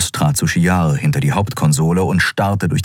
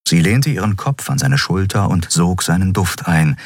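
plain reading voice

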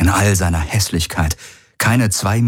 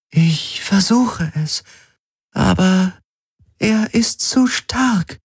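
höher und langsam, hilflos; Stimme der Helix; 13 semitones higher, slower than usual. A narrator's reading. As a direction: die KI kämpft vergeblich. Helix antwortet. (Helix gesteht Galen ihre Ohnmacht)

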